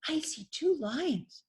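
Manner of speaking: slow, high little cub voice; little lion cub. Acted. Cub looking into the pond, slow high small voice. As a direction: answer as an awed small cub.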